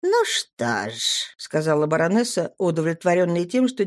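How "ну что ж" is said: calm, measured noblewoman; cool baroness. A narrator's reading. Baroness voiced, slow low.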